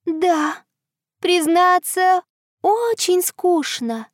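high, thin and slow; tiny high moon voice; thin little voice. Acted. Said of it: "тоненьким голоском".

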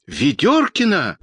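high, drawn-out cry; startled cry. A narrator's reading. Children's doctor hearing who is on the ladder, crying out. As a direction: cry the name out high and slow. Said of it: "закричал детский доктор".

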